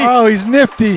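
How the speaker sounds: high, loud excited call; thrilled play call. Real, not acted.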